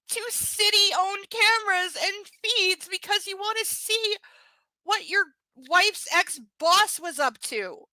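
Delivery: high, loud, slow exasperation; exasperated rant. Real, not acted. Reader ranting about illegal camera access, exasperated. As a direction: draw out each point in high, indignant disbelief.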